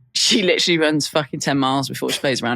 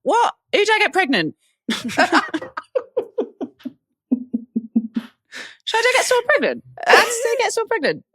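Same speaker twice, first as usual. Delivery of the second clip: high, squeaky shocked disbelief; confused friend. Retold or imitated. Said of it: "She'll be like".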